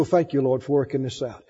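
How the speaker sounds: low, restful prayer of thanks; peaceful thanks. Acted. Believer resting instead of begging, low peaceful thanks. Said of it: "lay down in peace and you go".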